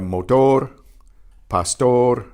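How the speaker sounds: motor, pastor ending in a short roll; two or three tongue flips on the last R of motor and pastor; Spanish motor, pastor with briefly trilled endings; lightly trilled final R. Shown as a demonstration. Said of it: "The final R is about halfway".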